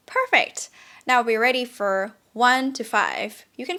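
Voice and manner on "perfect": ordinary talk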